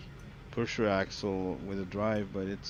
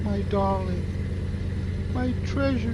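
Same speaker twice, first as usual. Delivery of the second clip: much higher, pleading movie-mother voice; hammed-up higher mother's cry; mock tearful mother; 10 semitones higher than usual. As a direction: gush like a long-lost mother from Twins.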